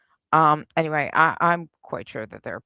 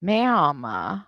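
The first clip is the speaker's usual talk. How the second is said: normal talking voice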